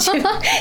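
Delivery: short high laugh. Real, not acted. Laughing while plugging the program.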